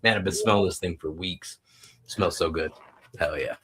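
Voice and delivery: usual speaking voice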